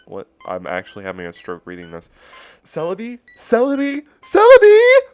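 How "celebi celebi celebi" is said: rising from a call to a piercing high shout; crying out. Retold or imitated. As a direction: call louder and higher each time until it breaks. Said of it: "I cried out".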